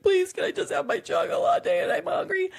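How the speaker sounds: put-on very high, pleading child voice; freaking-out kid. Kids losing their bedtime routine, mock freaking out. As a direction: imitate a frantic kid begging, hammed up. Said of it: "like, freaking out".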